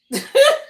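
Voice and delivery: sudden loud burst of high laughter. Real, not acted. Reader cracking up at the chatty spirit, loud high laugh.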